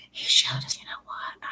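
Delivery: soft secretive whisper; retelling a whispered aside to a friend. Retold or imitated. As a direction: whisper the gossip. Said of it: "I'm like".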